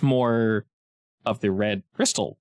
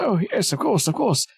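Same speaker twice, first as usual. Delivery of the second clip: lightly voiced obliging character, higher pitch; a hint of put-on agreeable voice; nodding character's eager agreement; 9 semitones higher than usual. Character grants a request with a nod, obliging put-on voice.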